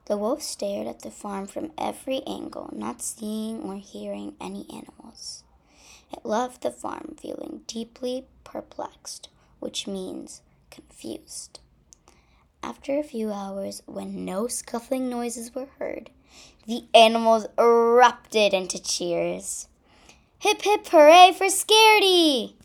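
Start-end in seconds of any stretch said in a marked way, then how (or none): 0.1-11.6 hushed whisper. A narrator's reading. Hushed scene, animals hiding. 20.4-22.5 high, loud cheer. Acted. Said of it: "they all cried".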